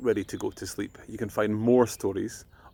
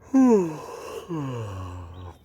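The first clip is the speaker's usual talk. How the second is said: sigh; 3 semitones higher than usual. A narrator's reading. Ella feeling tired.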